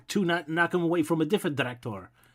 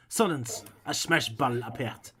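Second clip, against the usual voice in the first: usual speaking voice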